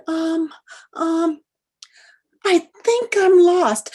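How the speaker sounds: high, loud, slow flustered excuse; embarrassed woman making up an excuse. Retold or imitated. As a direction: stall nervously and blurt the lie.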